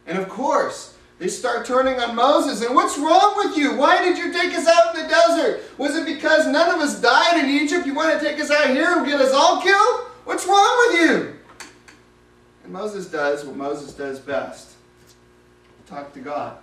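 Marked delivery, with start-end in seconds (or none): 2.6-11.3 loud, high accusing shouts; angry crowd shouting. Acted. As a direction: hurl the blame at Moses, much higher. People turning on Moses.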